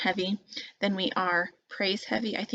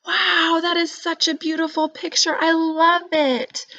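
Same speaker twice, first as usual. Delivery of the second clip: high, loud, gushing praise, slowing on I love it; 9 semitones higher, 6 dB louder than usual. Shown as a demonstration. Teacher showing how praise to a child's drawing sounds, gushing and excited. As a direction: heap on the praise like a thrilled grown-up. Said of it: "now this would be praise".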